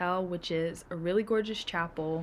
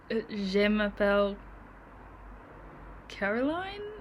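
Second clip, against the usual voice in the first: unsure tourist French. Retold or imitated. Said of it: "And I say".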